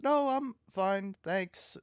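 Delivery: mock high, breezy brush-off; lightly imitating her polite dismissal; a high, airy no-thanks in her voice; Melena brushing him off. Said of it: "Melena just goes".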